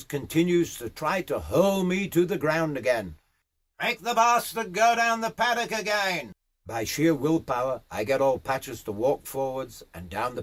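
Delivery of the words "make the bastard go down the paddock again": high, hollered command; riding boss bossing from afar; called-out order. Acted. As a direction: holler instructions down the paddock. Boss calls another order.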